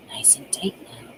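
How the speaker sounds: breathy whisper into her ear. A narrator's reading. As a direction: hush it, close and secret. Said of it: "whispered in her ear".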